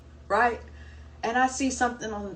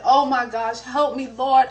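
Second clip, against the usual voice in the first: mock despair; 3 semitones higher, 7 dB louder, slower than usual. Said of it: "I started crying".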